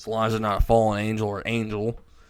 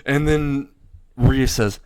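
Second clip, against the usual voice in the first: plain reading voice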